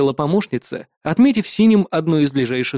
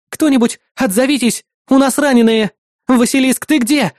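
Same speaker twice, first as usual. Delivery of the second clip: high, louder cry for help over comms; shouted appeal; 9 semitones higher, 5 dB louder than usual. A narrator's reading. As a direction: call out urgently for any answer. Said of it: "прокричала Сансет".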